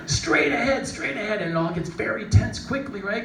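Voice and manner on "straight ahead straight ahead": high, loud guide shout; urgent shouted instruction fading down; calling directions. Retold or imitated. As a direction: call the line to the skier.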